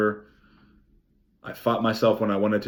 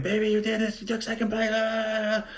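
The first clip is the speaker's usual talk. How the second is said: higher-pitched mock yell of a girlfriend cheering; mock excited yelling; 12 semitones higher, 4 dB quieter than usual. Jen running at him at the finish, mock high yell. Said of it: "yelling, like, screaming".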